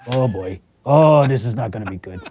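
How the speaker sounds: bracing for trouble, voice sinking. Retold or imitated. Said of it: "I'm like".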